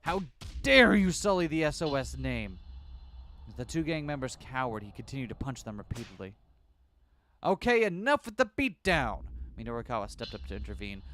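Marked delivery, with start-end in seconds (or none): 0.0-2.6 loud, slow outrage; wrathful; furious. Acted. Susumu beating his men. 7.4-9.2 loud, slow, high intervention; stepping in; calling out. Acted. Minorikawa stops the beating.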